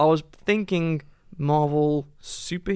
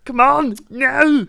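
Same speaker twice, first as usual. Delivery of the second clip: loud, high action-hero impression; Arnie; 10 semitones higher, 9 dB louder, much slower than usual. Friend hurrying the others to finish, Arnie impression. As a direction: yell it as a hammed-up action star. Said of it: "Was that your Arnie impression?".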